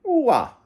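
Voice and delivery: high "Ooh-ah" dropping down; moose call. Retold or imitated. Dog's name as moose call.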